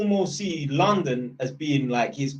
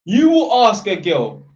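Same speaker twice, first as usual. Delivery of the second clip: usual speaking voice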